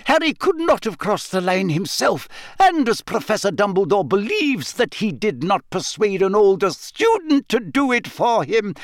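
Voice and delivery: put-on high, exasperated older woman; indignant. A narrator's reading. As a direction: brush the idea off crossly. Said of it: "She shot a very angry look".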